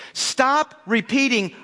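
ordinary talk

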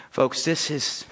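ordinary delivery

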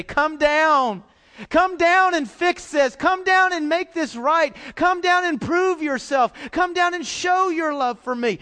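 loud, high, insistent begging; a believer loudly begging God to come down; pleading with heaven. Retold or imitated. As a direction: cry out to God demanding he act. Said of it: "look to heaven and say".

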